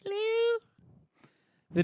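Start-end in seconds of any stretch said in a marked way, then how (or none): 0.1-0.6 very high put-on falsetto; a loud, high, squeaky hello; Jamie's double voice. Streamer slips back into the character voice, very high. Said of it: "Jamie Double voice".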